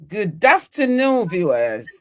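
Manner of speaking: ordinary talk, nothing marked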